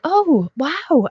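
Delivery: high-pitched, wowed "oh"; retold amazed realization. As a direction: recall a moment of sudden wonder. Retelling her sudden realization on seeing stories.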